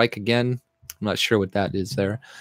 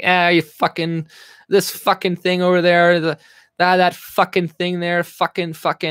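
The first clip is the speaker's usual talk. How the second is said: lightly voiced small-town local, raised pitch; higher, rambling cussing like the locals back home; imitating small-town swearers; 6 semitones higher, 3 dB louder than usual. Streamer imitating hometown folks, lightly voiced rambling swearing. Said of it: "that's how they, they talk".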